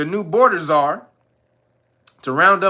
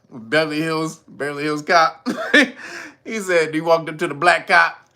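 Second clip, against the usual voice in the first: usual speaking voice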